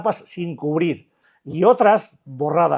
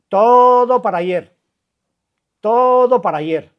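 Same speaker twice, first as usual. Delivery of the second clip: drawn-out, louder impatient demand; mock the craving for everything at once; slow, insistent "everything now"; 6 semitones higher, 7 dB louder, slower than usual. Mocking the addict's impatience.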